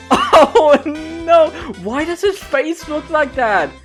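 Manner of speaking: laughing high oh no; hammed-up dismay; mock horror.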